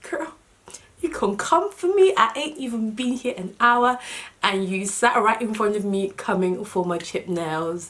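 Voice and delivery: high, loud sassy comeback; sassy clap back. Retold or imitated. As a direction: talk back with full attitude. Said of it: "And I just felt like".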